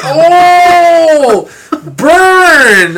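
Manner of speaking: long, loud, high hoot; drawn-out, shouted ooh at a sick burn; gleeful burn reaction. Retold or imitated. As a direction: crow over a savage insult. Acted crowd reaction to insult.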